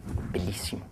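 breathy hushed whisper, almost voiceless; whispered aside. Real, not acted. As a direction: breathe the word out in awe. Whispered aside after quote. (sussurrato con stupore)

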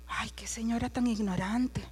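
whispered scornful aside. Retold or imitated. About the lady, a scornful whispered aside. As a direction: mutter it so she can't hear.

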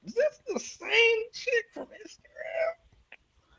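slow, high, hushed squeak; stunned disbelief. Retold or imitated. Guest retelling shock at Instagram chick, high and hushed. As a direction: squeak it softly and slowly, astonished.

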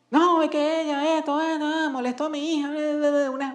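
high-pitched stream of mock grievances; mock complaining. Woman defends herself after the fight, mock complaining. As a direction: imitate an aggrieved mother rattling off complaints. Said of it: "un montón de quejas".

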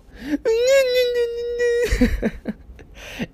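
high, hammed-up fake baby cry; mock baby wail. Mocking someone who comes at you crying, with a hammed-up baby wail. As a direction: wail like a grown adult in a baby mask.

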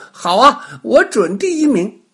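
put-on high, loud boyish boast; excited kid taking up a challenge; eager little boy. A narrator's reading. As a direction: pipe up as a confident small child. Little boy answering, voiced in story.

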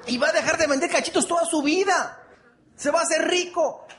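high-pitched comeback, hammed up for the crowd; mock retort. Speaker answering a lottery ticket seller, high mock retort. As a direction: throw it back at the seller, pitched well up.

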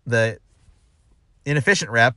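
ordinary talk

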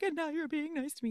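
high, tearful heroine imitation; mock weepy. Heroine answers his sudden apology, mock weepy. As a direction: whine it high through pretend tears.

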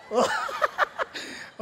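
a sudden high laugh at his own joke; high burst of laughter. Real, not acted. (biglang mataas na tawa)

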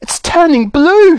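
very high, drawn-out cry of alarm; horrified scream. Acted. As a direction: shriek it slowly in horror. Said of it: "screamed Missus Beauregard".

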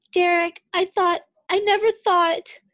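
high, loud tearful cry; crying softly. A narrator's reading. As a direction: sob the words out, breaking. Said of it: "crying s-, voice muffled by skin".

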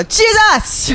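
high-pitched mock yell; hammed-up high outburst; imitated yell. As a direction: yell it out suddenly, imitating a friend's Gullah catchphrase. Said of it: "Like, just yell it".

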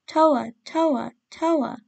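put-on narrated child's forlorn calling; heartbroken cry. A narrator's reading. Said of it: "heart brokenly cried".

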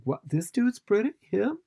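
high-pitched mock disbelief; hammed-up astonished questioning; incredulous. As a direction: react as a stunned fan, voice shooting up. Acted fan's disbelief.

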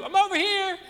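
put-on high, small kid's voice calling out; little kid hiding. A little boy calls from his hiding spot, high and playful. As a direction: pipe up like a three-year-old who's hiding. Child hiding in hide and seek.

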